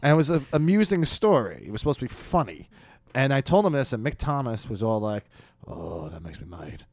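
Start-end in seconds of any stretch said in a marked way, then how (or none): 5.7-6.8 low, soft, slow grumble; mock brooding disapproval. Headliner reacting to a story meant as funny, low mock brooding. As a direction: imitate a man quietly taking offense.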